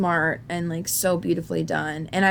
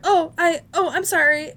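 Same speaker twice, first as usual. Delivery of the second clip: flustered apology; 10 semitones higher, 6 dB louder than usual. Retold or imitated. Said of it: "she basically was like".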